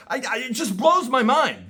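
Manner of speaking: half-shouted disbelief; exasperated outburst. Real, not acted. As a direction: let it burst out of you. Exasperated rant about ignorance.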